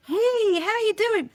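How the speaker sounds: high, chirpy greeter voice; cheery staff greeting. Retold or imitated. A park worker greeting the crying visitor, high and cheery. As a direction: greet a guest brightly. Voicing Hogwarts staff member.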